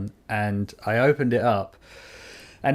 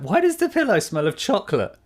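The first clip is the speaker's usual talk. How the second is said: puzzled; 8 semitones higher than usual. Retold or imitated. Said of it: "and I was like".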